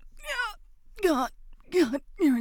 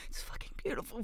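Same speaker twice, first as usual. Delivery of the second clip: whispered; 3 semitones higher, 8 dB quieter, faster than usual. Acted. Breathless moment of pleasure.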